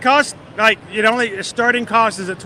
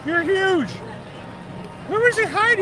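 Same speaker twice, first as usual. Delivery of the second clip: excited; 10 semitones higher than usual. Real, not acted. Greeting the Sully character excitedly.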